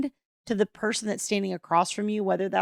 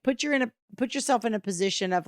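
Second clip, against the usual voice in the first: normal talking voice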